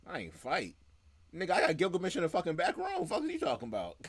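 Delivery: mock cocky fighter. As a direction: bark it out like a cocky brawler.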